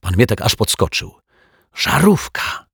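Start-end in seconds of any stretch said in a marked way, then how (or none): 1.8-2.6 hissed in pain. Acted. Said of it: "syknął detektyw".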